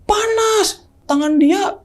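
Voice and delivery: very high-pitched mock tantrum wail; tantrum cry. Girlfriend crying out to Van for help, high-pitched tantrum. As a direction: imitate a tantrum in a high, drawn-out childlike screech. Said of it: "Cewek gua tantrum, teriak".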